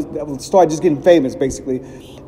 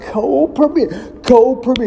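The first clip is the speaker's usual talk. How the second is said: high, sing-song chant of a name; 8 semitones higher than usual. Retold or imitated. Comedian chanting the group's name, high sing-song. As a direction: chant the name like a hype call. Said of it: "He said".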